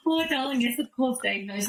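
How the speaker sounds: high, reassuring oh darling; soothing mother to her eight-year-old daughter; reassuring mum. Retold or imitated. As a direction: comfort a worried child.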